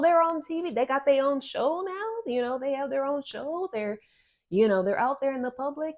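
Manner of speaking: lightly voiced relative spotting you on TV; gossiping relative. Estranged relatives gossip about your fame, mock excited. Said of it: "they're like".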